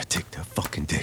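ordinary talk, nothing marked

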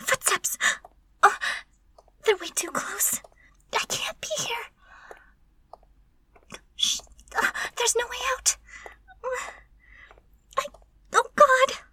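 high, breathy, frightened hiss; panicked whisper. Acted. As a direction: whisper in panic at being trapped. Trapped, footsteps approaching.

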